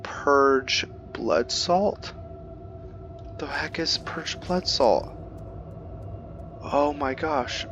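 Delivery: high, slow, drawn-out disbelief; baffled. Real, not acted. Gamer reading a weird item name, drawn-out disbelief. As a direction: stretch each word out in amused bafflement.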